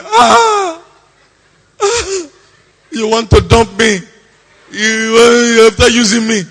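put-on high, loud wailing like a dumped girlfriend; hammed-up sobbing complaint; mock crying. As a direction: wail it out in exaggerated heartbreak. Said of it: "is not to cry".

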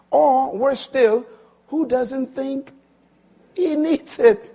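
high, loud, drawn-out preaching; full-force preaching. Acted. Preacher warning the congregation, full-force preaching. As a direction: drive it home from the pulpit.

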